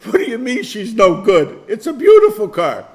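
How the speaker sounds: loud, high mock disbelief; incredulous protest. Retold protest to grandfather.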